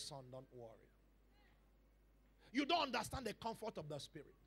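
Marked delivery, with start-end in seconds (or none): 0.0-0.9 very soft, low comforting voice; hushed and fatherly, soothing; tender reassurance. Retold or imitated. God comforting a weary believer, tenderly.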